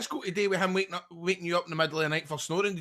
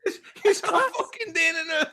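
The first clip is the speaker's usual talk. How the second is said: laughing; 12 semitones higher, 6 dB louder than usual. Real, not acted. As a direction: repeat it slowly through laughter, high. Repeating the punchline while laughing.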